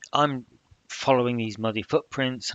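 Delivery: ordinary talk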